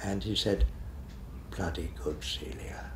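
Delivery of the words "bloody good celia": soft whispered compliment inches away; whispered praise. Retold or imitated. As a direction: whisper it close, like a pleased director. Director leans close, whispers praise.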